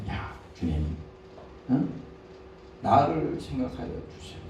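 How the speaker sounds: low, soft plea to the Lord; soft prayer. Retold or imitated. Believer praying humbly before the Lord, low and soft. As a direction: quietly ask the Lord to remember you. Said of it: "주님 앞에".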